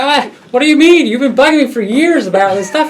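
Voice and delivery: high loud, laughing through it; husband protests to his wife, high, loud and laughing; laughing mock confusion. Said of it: "I'm like".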